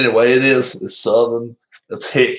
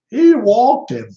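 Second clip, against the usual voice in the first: high, loud, stretched-out outburst; exclaiming in disbelief; 11 semitones higher, 5 dB louder, slower than usual. Real, not acted. Streamer reacting to his pitcher's walk, incredulous outcry. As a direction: repeat it high and slow, incredulous. Dismayed repeat after a walk.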